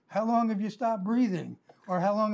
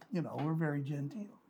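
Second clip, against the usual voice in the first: whisper; 5 semitones lower, 9 dB quieter than usual. Real, not acted. Mocking genteel church talk.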